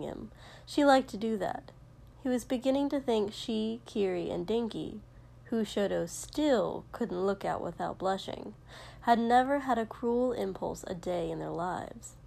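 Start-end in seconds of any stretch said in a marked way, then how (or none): none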